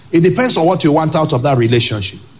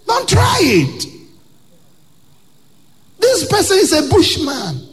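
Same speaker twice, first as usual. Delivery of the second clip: much higher, shouted warning; 12 semitones higher than usual. Real, not acted. Preacher warning young women away, shouted high warning. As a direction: warn them off at full pitch. Preacher warns at full force.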